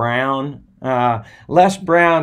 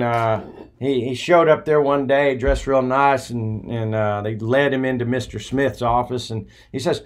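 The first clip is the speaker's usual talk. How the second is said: normal talking voice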